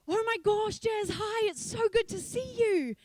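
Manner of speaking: high, loud joyful greeting; delighted pastor. Retold or imitated. The senior pastor greeting Jazz at the door, high and delighted.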